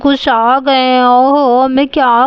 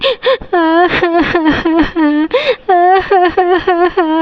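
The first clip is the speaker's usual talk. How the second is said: put-on child sobbing; 3 semitones higher than usual. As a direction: cry like a scolded little girl. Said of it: "ये रोने की आवाज़".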